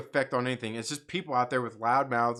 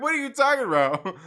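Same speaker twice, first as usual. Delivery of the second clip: incredulous; 10 semitones higher, 6 dB louder than usual. Retold or imitated. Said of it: "I'm like".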